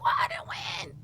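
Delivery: very high, plaintive question; baffled whine. Retold or imitated. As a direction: complain in disbelief. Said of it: "I be like".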